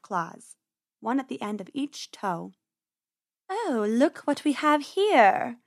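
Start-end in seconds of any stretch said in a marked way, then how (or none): 3.5-5.6 slow, higher, silky menace; drawn-out predatory purr; purring cat. Acted. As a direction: purr it like a cat eyeing prey. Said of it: "she purred".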